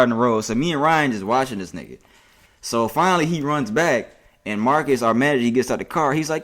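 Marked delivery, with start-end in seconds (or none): none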